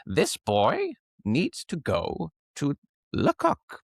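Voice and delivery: theatrical mentor voice, slow and lofty; imitating the mentor. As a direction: drawl it out like an eccentric old mentor. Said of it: "and this is how he talked".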